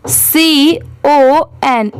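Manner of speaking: letters drawn out very slowly while writing; slow spelling. Shown as a demonstration. Teacher writes on the board, spelling slowly. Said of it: "मैं आपको यहाँ पर लिख के दिखाती हूँ".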